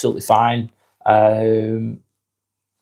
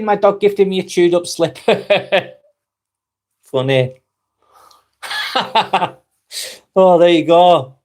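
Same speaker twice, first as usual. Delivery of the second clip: real giggles breaking into the words; amused, higher voice cracking up with laughter; laughing; 6 semitones higher, 3 dB louder than usual. Real, not acted. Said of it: "Sorry, I'm just laughing".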